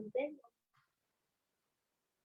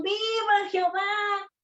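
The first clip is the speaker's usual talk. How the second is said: full-lung shout; 7 semitones higher, slower than usual. Retold or imitated. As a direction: belt it out like a crowd cheering. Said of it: "ellos gritaban, ¿no? A todo pulmón".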